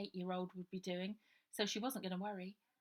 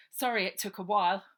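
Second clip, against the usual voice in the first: put-on louder, offhand boy's voice; Harry downplaying his fight to Ida; 2 semitones higher, 14 dB louder than usual. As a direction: shrug off the delay. Harry apologising with hurt arm.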